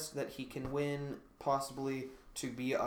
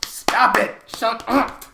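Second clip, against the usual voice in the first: laughing protest; 8 semitones higher, 14 dB louder, slower than usual. Real, not acted. Friend mocks cramp.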